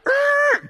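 high, loud squeal of slamming brakes; mock screech. Pastor imitates drivers braking for a cop, high loud squeal. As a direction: yelp like tires braking hard.

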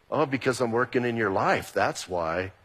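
low, soft, calm divine reply; God's patient answer. Retold or imitated. God answering a complaining believer, low and calm. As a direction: answer gently like a patient father.

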